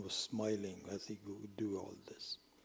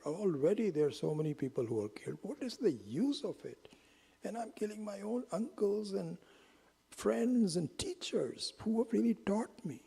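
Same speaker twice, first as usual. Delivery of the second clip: much higher, lamenting plea; mock wailing Arjuna; 10 semitones higher than usual. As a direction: cry out your despair about the war. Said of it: "Arjuna is wailing".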